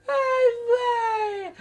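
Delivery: put-on high loud wailing oh boy; exasperated cry. Comedian wails to the audience, high and loud. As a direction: cry it out to the crowd. Comic exclamation between jokes.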